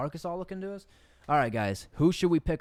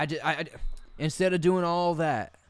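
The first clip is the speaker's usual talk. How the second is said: ordinary talk, nothing marked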